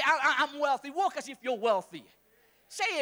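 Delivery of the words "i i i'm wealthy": mocking boast. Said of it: "Don't just say".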